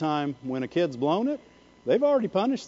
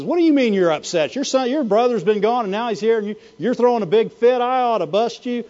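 mad dad; 3 semitones higher, 4 dB louder than usual. Acted. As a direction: scold like an angry father, picking up pace. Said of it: "he's mad and he says".

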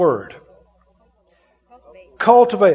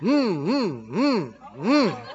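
soft wavering hums; imitated engine cranking; 3 semitones lower, 7 dB quieter, much slower than usual. Getting in the car that won't start, imitated cranking hum. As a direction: hum in rising and falling surges like a starter.

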